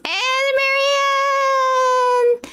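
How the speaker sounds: high, long drawn-out delighted call; excited call. Real, not acted. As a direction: stretch the name out in glee. Excitedly calling out a character.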